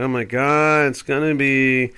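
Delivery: lightly voiced mock alarm, drawn out at the end; mock panic. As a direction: mimic a frightened pundit. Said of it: "They get all scared".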